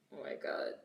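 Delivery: breathy exasperated "oh my God"; under your breath, fed up; whispered. Real, not acted. Exasperated at the chat.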